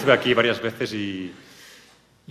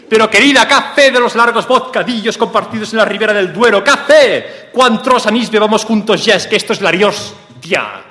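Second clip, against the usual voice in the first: high and loud throughout; overacted loud declaration; 10 semitones higher, 11 dB louder than usual. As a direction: bellow it like a tragic lover.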